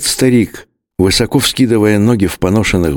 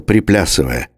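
ordinary delivery

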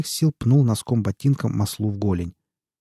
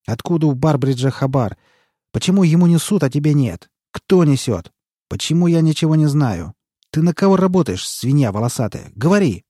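angry shouting; 5 semitones higher, 6 dB louder than usual. A narrator's reading. Boss interrogates underling, shouting.

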